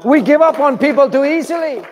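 loud, high rebuke; full-force preaching. Real, not acted. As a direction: call the church out.

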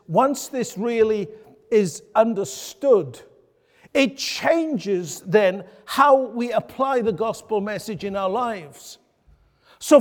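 firm, emphatic pulpit delivery; preaching. Real, not acted. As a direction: preach it out to the congregation. Sermon at full preaching force.

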